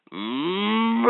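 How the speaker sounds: a soft moo; cow moo. Acted. Toy asking the child which animal, soft moo.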